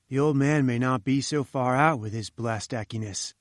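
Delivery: ordinary delivery, nothing marked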